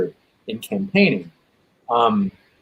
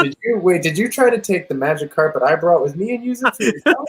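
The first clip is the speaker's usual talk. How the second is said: lightly voiced, a touch higher, affronted; mock indignant rival; 5 semitones higher than usual. Candidate accusing another of stealing his move, mildly put-on indignation. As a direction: play a rival who claims his trick was stolen.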